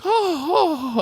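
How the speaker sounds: imitated creature excitement. Acting Harry seeing the deer.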